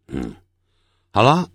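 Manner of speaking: normal talking voice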